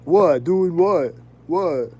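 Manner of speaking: high, loud defensive what; mock defensive. Strangers acting innocent, loud defensive retort. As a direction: play dumb, snapping back. Stalkers feign ignorance, retold.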